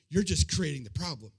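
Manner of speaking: low-pitched retold criticism; disapproving remark. Companion criticizing the sandwich handouts, low disapproval. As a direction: drop the pitch and pass judgment. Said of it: "one more time".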